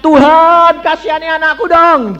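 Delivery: very high, loud begging; hammed-up plea to God; mock pleading prayer. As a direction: beg God in an exaggerated high voice. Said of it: "Apakah lalu bilang".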